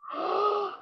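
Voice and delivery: high sharp gasp of fear; startled lizard gasp; frightened gasp. Acted. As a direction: suck in breath in panic. Lizard wakes in snake's clutch.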